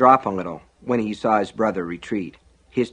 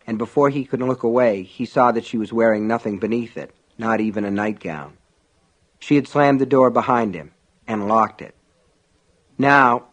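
ordinary delivery, nothing marked

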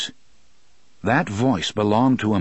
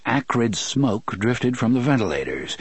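ordinary delivery, nothing marked

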